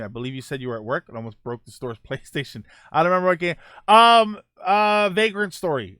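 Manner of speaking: ordinary talk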